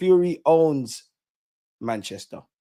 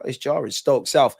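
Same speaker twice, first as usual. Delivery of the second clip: ordinary delivery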